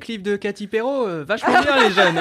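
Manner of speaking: laughing while pretending to be an out-of-touch elder; laughing mock boomer. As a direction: play an out-of-touch elder, giggling. Mock old person, laughing.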